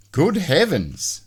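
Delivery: high, slow and aghast; prim horror at the mess; shocked good heavens. A narrator's reading. Mister Neat sees the messy house, slow and aghast.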